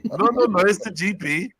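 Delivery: insistent doctor. Retold or imitated. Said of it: "GP insisting".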